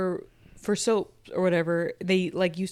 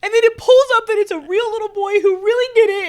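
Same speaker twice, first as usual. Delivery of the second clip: high, loud, tearful laugh; choked up and laughing at herself; on the verge of tears; 12 semitones higher, 6 dB louder than usual. Real, not acted. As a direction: tell it excitedly while close to crying. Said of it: "I'm gonna cry again".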